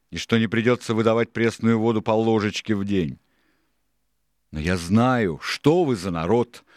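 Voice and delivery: forceful pirate boss scolding; Silver, scornful. A narrator's reading. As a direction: berate a useless crew. Silver berates the crew.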